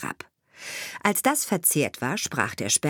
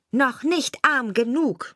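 taunting sparrow; 7 semitones higher, 4 dB louder than usual. A narrator's reading. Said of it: "sprach der Sperling".

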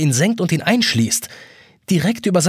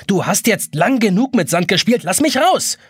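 getting angry; 7 semitones higher than usual. A narrator's reading. The boy angrily demands the hourglass release him. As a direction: lose patience and order it. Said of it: "Jonas wird langsam böse".

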